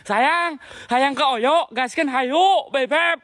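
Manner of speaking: high-pitched, drawn-out sweetheart call; teasing lover's call. Pretend. Ghost hunter calling "sayang" into the dark, high teasing call. Calls out teasingly to unseen presence.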